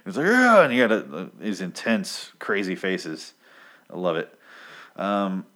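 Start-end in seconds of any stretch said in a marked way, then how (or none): 0.3-0.6 much higher, louder cartoon shout; mock comic yell. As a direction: bark out a comic-book scream. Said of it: "And that's how they yell".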